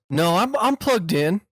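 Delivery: high-pitched granny fumbling with her computer; Southern old lady. Acted. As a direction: play dumb like a sweet old grandma. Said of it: "pretended like I was a, just a Southern idiot".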